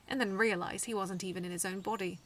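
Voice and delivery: plain reading voice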